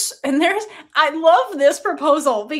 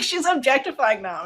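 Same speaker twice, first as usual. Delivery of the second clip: ordinary delivery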